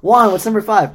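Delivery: loud, high teacher calling on a student; stern teacher voice. Retold or imitated. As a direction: bark out the question like a teacher.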